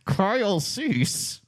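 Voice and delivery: a squeaky dummy's voice; ventriloquist voice. Shown as a demonstration. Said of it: "ventriloquist voice".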